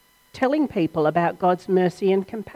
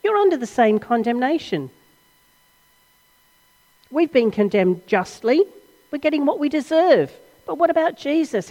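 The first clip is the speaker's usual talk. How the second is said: rebuking; 7 semitones higher, 4 dB louder than usual. Acted. Rebuking the other criminal.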